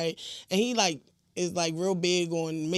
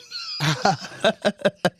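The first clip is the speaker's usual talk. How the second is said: laugh. Real, not acted. Laughing at own story.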